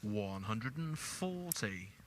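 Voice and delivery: slow drawn-out score call; darts score caller. Real, not acted. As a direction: stretch the number out like a referee.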